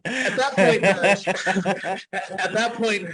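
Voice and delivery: can't get the words out for laughing. Real, not acted. Telling podcast hosts the ESPN punchline, laughing. Laughing at his own joke.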